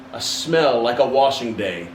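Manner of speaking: usual speaking voice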